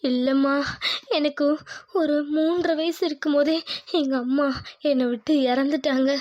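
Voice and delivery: choked-up, halting confession through tears; tearful. Acted. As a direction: tell it haltingly, close to crying. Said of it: "எதுக்கு இப்ப அழுகுற". (அழுகையோடு தழுதழுத்த குரலில்)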